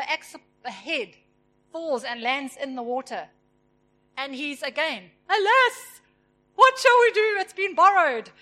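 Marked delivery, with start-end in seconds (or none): 5.3-8.3 high, loud hammed-up nervous cry; mock panic. Woodcutter who lost the borrowed axe head, mock panic. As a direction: overplay the worker's alarm at the lost axe. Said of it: "now nervous now".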